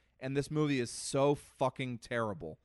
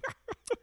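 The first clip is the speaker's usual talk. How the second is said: heavy sigh. Retold or imitated. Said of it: "A lot of heavy sighs".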